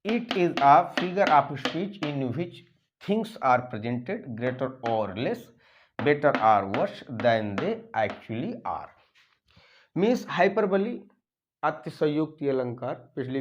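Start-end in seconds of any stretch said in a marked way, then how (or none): 0.0-8.9 whole definition read slowly; slow dictation. Shown as a demonstration. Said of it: "reading the complete definition".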